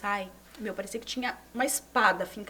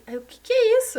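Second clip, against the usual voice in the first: alarmed; 11 semitones higher, 10 dB louder than usual. Retold or imitated. Hearing she may have thrombosis.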